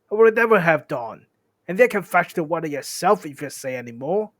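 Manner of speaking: loud, cross troll retort; troll scolding. Acted. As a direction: scold loudly and indignantly.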